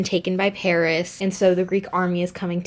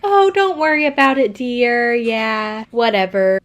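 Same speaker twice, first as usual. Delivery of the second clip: higher, louder, breezy brush-off; mock dismissive dad; 5 semitones higher, 5 dB louder than usual. King brushing off his daughter's question, breezy. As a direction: wave it off like a shifty dad. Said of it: "Agamemnon's kinda like".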